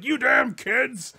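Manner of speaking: put-on slow, high, loud old grump; sputtering foiled villain. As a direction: shake your fist and yell slowly. Fist-shaking shopkeeper voiced.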